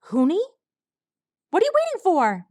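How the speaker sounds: put-on high young colt, shouting urgently. A narrator's reading. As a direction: cry out, high and pressing.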